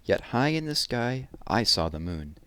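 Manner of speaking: put-on lively, lilting read-aloud to children; poem reading. A narrator's reading. Teacher reading a summer poem to children, lively and lilting. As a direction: savour each image, bright and rhythmic.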